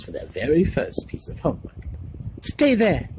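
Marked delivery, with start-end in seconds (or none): 2.4-3.1 urgent. A narrator's reading. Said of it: "she said".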